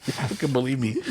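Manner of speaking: ordinary talk, nothing marked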